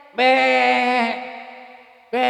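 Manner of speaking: drawn-out imitated goat bleat. Preacher mimics someone always crying for help, goat bleats. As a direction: bleat like a goat begging attention. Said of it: "Itu namanya minta diprotek".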